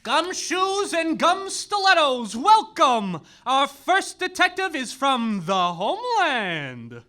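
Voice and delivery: normal talking voice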